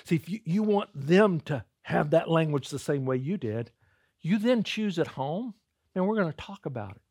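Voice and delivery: ordinary talk, nothing marked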